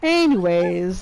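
normal talking voice

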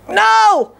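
high, drawn-out mock "no"; defiant pinned brother. Brother underneath refusing to surrender, high mock protest. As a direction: refuse from underneath, pitch thrown way up.

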